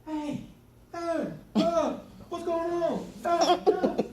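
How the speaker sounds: high-pitched, noisy little kid impression; loud toddler. Two-year-old piping up during a talk, loud. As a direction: babble loudly like an excited toddler. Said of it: "they'll be loud".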